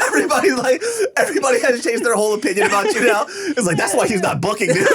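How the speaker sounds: high, loud, rapid speech through laughter; real laughter pushing the voice up high; laughing hard. Real, not acted. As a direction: tease while cracking up. Laughing hard at friend's acting.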